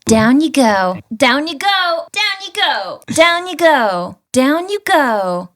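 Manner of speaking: put-on high, cute anime girl. Shown as a demonstration. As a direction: squeal it like an anime heroine. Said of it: "in, like, an anime girl voice?".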